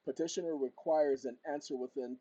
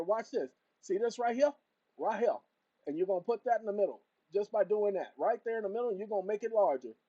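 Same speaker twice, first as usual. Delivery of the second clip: loud, higher, pumped-up instructions; fired-up teaching; 6 semitones higher, 7 dB louder than usual. Real, not acted. Showing viewers how to place the petition, excited loud teaching. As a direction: hype the viewers through each step.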